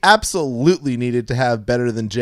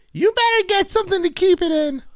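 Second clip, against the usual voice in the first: very high put-on voice, not at all defeated; high-pitched storybook character. A narrator's reading. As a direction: squeak the line out in a high character voice. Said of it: "she sighed defeatedly".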